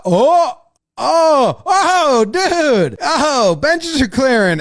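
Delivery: high-pitched excited whooping; rising fan excitement, hammed up; acted excited fan. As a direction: get thrilled as a brawl breaks out. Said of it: "all excited 'cause the benches are clearing".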